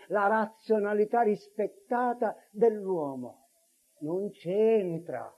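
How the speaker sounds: usual speaking voice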